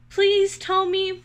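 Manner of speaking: high, loud, slow pleading; insisting. A narrator's reading. Squirrel begging woodpecker for an answer, high and loud. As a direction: beg him to tell you, drawn out. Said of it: "Squirrel insisted".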